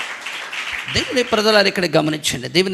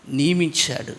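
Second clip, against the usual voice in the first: normal talking voice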